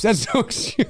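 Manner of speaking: words broken by a laugh. Real, not acted. Amused at critics, laughing through it. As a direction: crack up mid-sentence.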